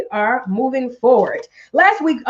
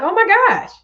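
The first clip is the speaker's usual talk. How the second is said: mock surprise; 9 semitones higher than usual. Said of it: "She was like".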